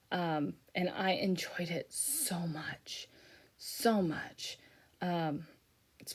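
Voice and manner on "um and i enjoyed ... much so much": soft fond whisper. Real, not acted. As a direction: breathe it out softly. Fond memory of the ocean.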